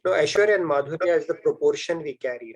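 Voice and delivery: usual speaking voice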